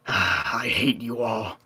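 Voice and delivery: soft, slow, weary sigh of contempt; sighing mock disgust. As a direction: let it drop into a tired groan. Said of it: "bartender and goes".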